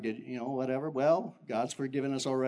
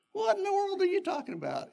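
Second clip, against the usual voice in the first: high, incredulous comeback; indignant retort. Retold or imitated. Pastor snapping back at Dan, indignant. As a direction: fire it back in disbelief, pitched up.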